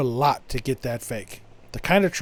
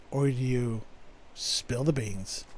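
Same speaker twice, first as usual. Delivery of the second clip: hushed teasing; 5 semitones lower, 9 dB quieter, slower than usual. Acted. Teasing aside about the dilemma.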